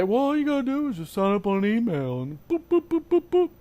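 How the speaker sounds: dismissive know-it-all voice; breezy mock with boops; mocking naysayer. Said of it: "people out there that want to say".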